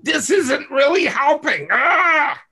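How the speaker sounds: high, loud, near-crying outburst; acting out your own insecure inner voice, breaking into a cry; frustrated inner shout. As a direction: let the frustration burst out in a high yell. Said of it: "That's you inside".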